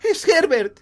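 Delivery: high, loud exclamation; frantic cry. Acted. Mother hears knocking, thinks son returned, frantic. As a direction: burst out in wild hope.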